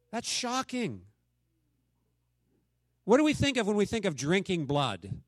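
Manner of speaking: usual speaking voice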